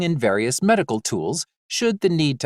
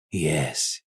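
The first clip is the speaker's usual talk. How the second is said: much softer, low yes; quiet; 9 semitones lower, 6 dB quieter than usual. Acted. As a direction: answer quietly, moved. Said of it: "Giovanni said quietly".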